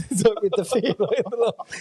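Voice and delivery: laughing while telling. Real, not acted. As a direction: laugh through the words of the memory. Laughing at his own panic.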